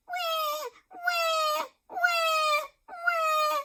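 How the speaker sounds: put-on high wailing baby wah; baby crying. A narrator's reading. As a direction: wail like an unhappy baby. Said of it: "started to cry".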